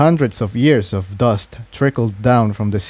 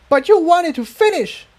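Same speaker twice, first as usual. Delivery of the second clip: high outburst, much higher pitch; shouting. A narrator's reading. Incendia shouts at Jigsaw in anger. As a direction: cry it out at them. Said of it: "Incendia shouted".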